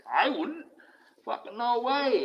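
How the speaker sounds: high, loud no way; viewer's complaint about money, high incredulous scoff; incredulous outburst. Real, not acted. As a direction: scoff at the very idea.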